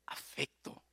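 soft whispered word. Real, not acted. Preacher whispering the key word. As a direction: breathe it gently. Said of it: "whispered key word".

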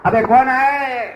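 loud, high, drawn-out challenge; bluffing roar. Shown as a demonstration. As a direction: bellow it slowly to scare off a thief. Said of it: "બધું ફૂંફાડા ફૂંફાડા બધું કરવાનું". (ડરાવવા મોટેથી ફૂંફાડો)

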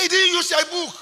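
high, loud exclamation; retold outcry. Man reporting the fraud to the narrator, high loud outcry. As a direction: blurt it out at full volume. Acts out shocked exclamation in story.

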